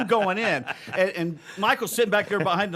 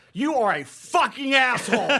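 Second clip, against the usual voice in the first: angry outburst; 6 semitones higher than usual. Retold or imitated. Kicking the door open on the coworker, yelling. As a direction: spit the insult at him through the door. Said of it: "and I go".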